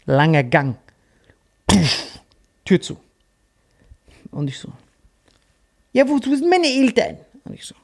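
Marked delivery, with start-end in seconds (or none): 5.9-7.2 put-on higher worried little girl; child's voice. Lost girl asks for parents, high child voice. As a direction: ask it like a lost kid. Girl's voice in retold story.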